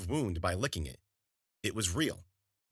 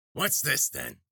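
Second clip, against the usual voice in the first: put-on curious old witch; 7 semitones higher, 4 dB louder, slower than usual. A narrator's reading. Witch inspecting the egg.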